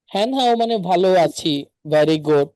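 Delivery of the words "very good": ordinary talk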